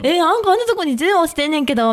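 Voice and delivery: Kansai dialect. Retold or imitated. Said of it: "可愛いな、関西弁".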